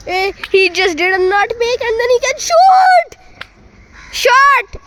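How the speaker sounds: excited shot call. Real, not acted. Commentator following a nutmeg into a shot, rising excited shout. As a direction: voice climbing to a high, loud shout. Calling a live shot at goal.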